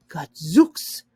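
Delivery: loud, startled Gadzooks; surprised exclamation. A narrator's reading. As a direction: blurt it out in amazement. Mrs Bede exclaims.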